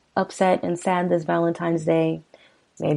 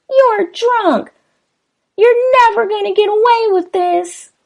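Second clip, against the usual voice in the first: much higher, pleading wife voice, slow; voiced frightened wife; 7 dB louder than usual. Host reading lyrics gives the wife a high, frightened plea. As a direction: pitch way up as the scared wife.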